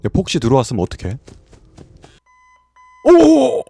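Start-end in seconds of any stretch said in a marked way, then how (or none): 3.1-3.6 panicking gamer. Real, not acted. Startled by Foxy.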